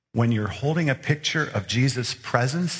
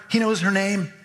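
ordinary talk

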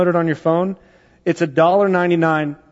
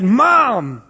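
higher, louder excited call of Mom; a child bursting with excitement; imitating an eager four-year-old; 5 semitones higher, 4 dB louder than usual. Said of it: "she was really excited".

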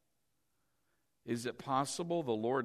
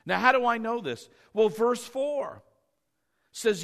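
full-force preaching; 6 semitones higher, 5 dB louder than usual. Acted. Pastor proclaiming God keeps his word to the congregation, full-force preaching. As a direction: preach it out with rising fervor.

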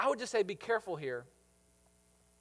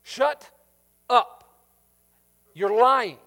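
loud, higher stern rebuke; angry Samuel; 5 semitones higher, 11 dB louder, much slower than usual. Retold or imitated. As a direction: cut him off hard. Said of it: "He goes".

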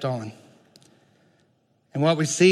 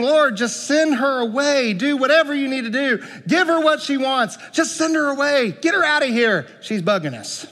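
high-pitched exasperated begging, dropping at the end; hammed-up irritated plea; 7 semitones higher than usual. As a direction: act out disciples desperate to be rid of someone. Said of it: "The disciples are irritated".

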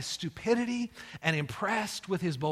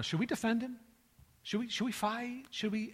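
soft, higher, dithering questions; hushed mock indecision, pitched up; hesitant asking; 4 semitones higher, 7 dB quieter than usual. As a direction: ask timidly, as disciples unsure what to do. Disciples stand around dithering.

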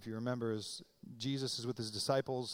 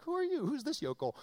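high-pitched, incredulous mock challenge; protective indignation; 11 semitones higher than usual. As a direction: challenge the stranger in a comically high, defensive voice. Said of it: "I'm feeling super kinda protective".

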